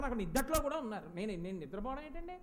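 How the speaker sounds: usual speaking voice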